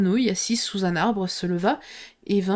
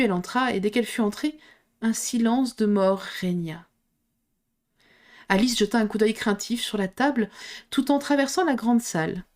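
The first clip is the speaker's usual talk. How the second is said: ordinary delivery, nothing marked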